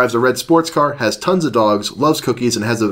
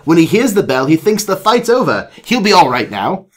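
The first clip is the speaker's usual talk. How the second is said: higher storybook British voice; British doctor; 6 semitones higher than usual. Acted. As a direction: explain it with assurance, British accent. British doctor explains his trick.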